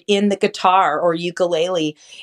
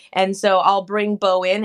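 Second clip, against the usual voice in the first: ordinary talk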